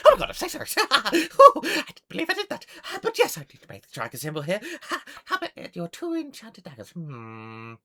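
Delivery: high, loud, rapid gnome gushing; flustered gnome. Acted. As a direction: squeal an apology, laughing, then settle to business. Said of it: "And goes".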